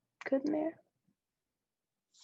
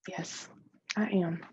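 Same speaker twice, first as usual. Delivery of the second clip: ordinary delivery, nothing marked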